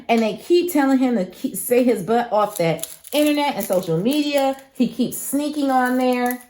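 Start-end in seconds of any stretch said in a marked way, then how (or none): none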